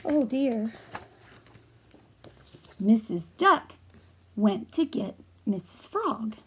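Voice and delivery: ordinary delivery, nothing marked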